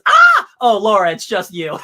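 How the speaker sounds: sudden high loud shriek, then relief; startled scream. Retold or imitated. Boom Boom startled by Laura, shrieking then relieved. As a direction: jump at a scare, then laugh it off. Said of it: "She goes".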